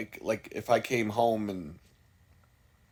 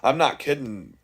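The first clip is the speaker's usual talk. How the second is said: ordinary delivery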